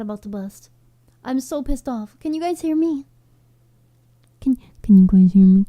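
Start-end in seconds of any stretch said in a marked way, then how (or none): 4.4-5.6 loud, urgent call into the mic; frustrated loud call to viewers; shouting to be heard. Real, not acted. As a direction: yell it so the chat can hear. Calling out to silent chat.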